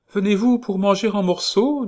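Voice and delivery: high, loud motherly landlady voice; kindly landlady. Acted. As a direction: chirp it like a bustling landlady.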